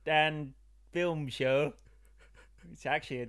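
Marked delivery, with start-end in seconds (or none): 0.1-1.7 slow, drawn-out hillbilly twang. Pretend. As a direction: stretch it out like a hillbilly. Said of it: "Are you doing a hillbilly voice".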